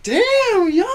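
high, drawn-out mock amazement; impressed crowd reaction. Party crowd reacting to the kid's rapping, mock amazed. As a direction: mimic a wowed crowd, stretched high.

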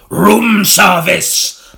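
loud, high, dragged-out boom; booming demon girl. A narrator's reading. Demon girl boomed at William, loud and slow. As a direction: let it boom down the corridor. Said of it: "the little girl boomed".

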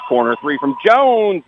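rising, stretched-out shot call; excited play-by-play. Real, not acted. Live call of a three-point attempt.

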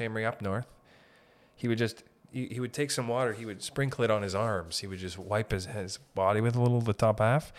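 ordinary talk

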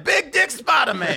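loud, high, drawn-out Southern announcement; Southern accent. Acted. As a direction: proclaim it slowly in a hammed-up Southern holler. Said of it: "get all Southern with it".

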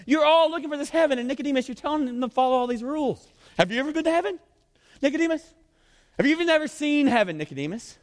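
high, insistent questioning; Jesus challenging. Acted. Preacher voices Jesus confronting Nicodemus about heaven, high and challenging. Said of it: "And so Jesus is going".

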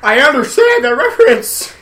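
high, loud, proud announcement; exaggerated quoting shout. Topping a friend who knows the reference, hammed-up shout. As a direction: holler it with a laugh. Quoting a film line loudly.

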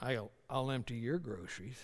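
low, soft, loaded innuendo; suggestive innuendo. Retold or imitated. Husband teasing his wife with a low suggestive line. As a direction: lean on it with a knowing hint. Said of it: "And I'm like".

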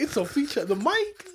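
high mock-innocent reply; mock excuse. Voicing the friend's excuse to his buddy, high mock voice. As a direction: toss it off in a high put-on voice.

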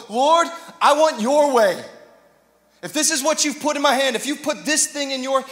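loud, driving preacher cry; full-force preaching. Acted. Pastor pleading with the church, loud and driving. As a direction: lift the room with it.

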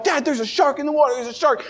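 high-pitched panicked cry to dad; a kid sure there's a shark, frantic; panicked shark alarm. Retold or imitated. Seven-year-old on the boat warning dad of a shark, high panicked cry. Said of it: "And so I started freaking out".

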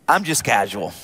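low, laid-back self-portrait; low-voiced nonchalance; mock casual. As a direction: play it cool, dropping the pitch way down. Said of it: "here, here's me".

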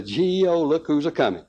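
ordinary delivery, nothing marked

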